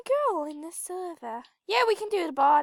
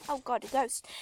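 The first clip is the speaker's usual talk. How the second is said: ordinary talk, nothing marked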